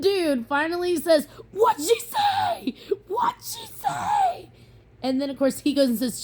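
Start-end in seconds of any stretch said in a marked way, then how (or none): 1.5-4.5 very high, slow shouted call across the park; calling out from afar. Retold or imitated. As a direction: yell it across the crowd. Dude shouting question from crowd.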